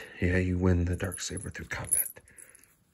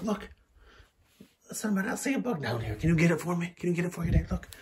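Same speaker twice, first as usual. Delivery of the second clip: pet talk; 8 semitones higher than usual. Real, not acted. High coaxing talk to pet.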